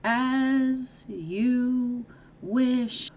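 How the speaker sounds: high, very slow, stretched-out call; drawn-out calling out. Retold or imitated. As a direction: call it out as if falling away, each word stretched. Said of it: "he starts to call out".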